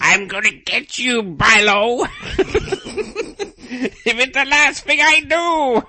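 high, hammed-up villain threat with cackle; loud, high mock-evil voice; cartoon nemesis. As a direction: play the arch-villain vowing revenge. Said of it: "I'll be your nemesis".

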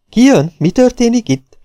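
high, loud, urgent questions; alarmed questioning. A narrator's reading. Policeman questions the panicked newcomer, high and urgent. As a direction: demand to know, in alarm.

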